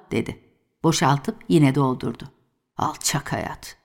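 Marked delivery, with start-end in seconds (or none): 2.8-3.8 low, soft mutter under the breath. A narrator's reading. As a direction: mutter it bitterly to yourself. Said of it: "diye mırıldandı". (alçak sesle mırıldanarak)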